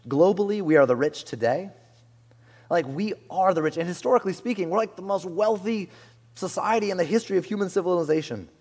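ordinary delivery

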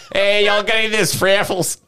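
put-on hammy Wisconsin accent, laughing. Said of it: "It just sounds very Wisconsin".

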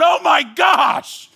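slow, high shocked exclamation, near a shout; stunned, loud, drawn-out dismay; terrified gasp. Retold or imitated. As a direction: let the horror hit you mid-word. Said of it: "And I'm going".

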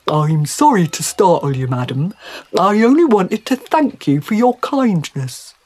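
apologetic, grateful fish; courteous talking trout. Acted. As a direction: play a polite trout addressing a lady.

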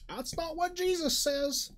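high, slow scolding voice; prim, disapproving sister wife. Retold or imitated. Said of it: "They're all gonna point and be like".

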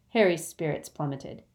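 plain reading voice

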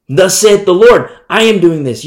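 proclaiming prophet. Acted. Amos declaring God's judgment to the hungry people, loud. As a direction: proclaim it with force. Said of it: "So he says to the people".

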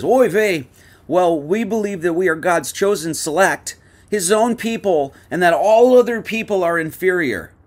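rabbi voice. Acted.

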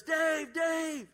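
high, loud call across the street; imitating the neighbor hailing him; calling out a name. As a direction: shout the name to catch his attention.

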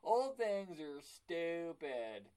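slow dismissive grump; drawn-out mock complaining; mocking listener voice. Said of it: "people listening to this were like".

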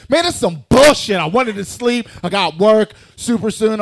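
loud, high-pitched mock complaint; hammed-up indignant outburst; annoyed neighbour. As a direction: imitate a woken-up neighbour griping loudly. Voicing annoyed neighbours woken by alarm.

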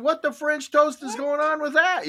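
put-on high, baffled child's voice; little kid. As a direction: imitate a bewildered, indignant kid. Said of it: "I'm a little kid going".

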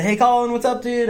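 imitated calling out. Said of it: "I'm yelling at whoever walks through".

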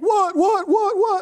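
high, loud, rapid-fire "what"s; imitated frantic questioning. Preacher voicing wife and kids' reaction, frantic high questioning. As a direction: imitate a startled family all asking at once.

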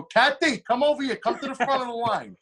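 high-pitched call to someone across a room. Retold or imitated. Comedian calls a regular fan up the line, calling out.

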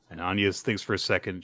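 ordinary talk, nothing marked

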